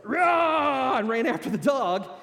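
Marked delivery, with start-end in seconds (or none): 0.0-1.0 high, loud roar to scare off a dog; imitated yell. Wife yelling at the vicious dog to scare it off. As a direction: bellow it out like you're scaring a dog away. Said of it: "raises her hands up like this and went".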